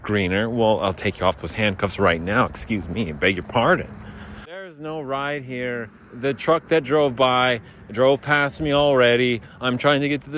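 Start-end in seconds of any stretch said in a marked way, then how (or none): 4.5-5.9 soft, slow complaint; slow stranded lament. Acted. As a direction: drag it out slowly. Stranded drunk.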